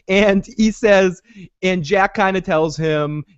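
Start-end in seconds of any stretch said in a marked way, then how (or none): none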